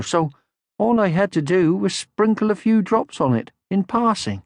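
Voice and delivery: high, gentle motherly voice; kindly mother troll; Moomin Mama. Acted. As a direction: soothe him like a calm mother.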